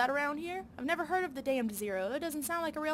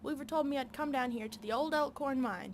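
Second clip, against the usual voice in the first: ordinary talk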